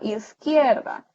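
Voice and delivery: "izquierda" drawn out slowly, stressing "quier"; stress on middle syllable. Shown as a demonstration. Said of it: "va como en medio". ("izquierda" lento, alargando la sílaba "quier")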